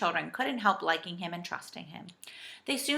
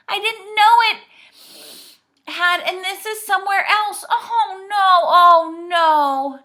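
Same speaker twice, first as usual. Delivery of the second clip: high, loud lament; shrieking dismay; 11 semitones higher, 12 dB louder, slower than usual. A narrator's reading. Saucepan man sees another land, wailing. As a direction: wail in panic and dismay. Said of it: "shrieked the saucepan man".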